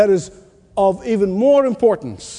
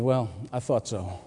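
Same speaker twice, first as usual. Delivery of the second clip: muttered inner thought; 8 semitones lower, 10 dB quieter than usual. Retold or imitated. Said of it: "in his mind said".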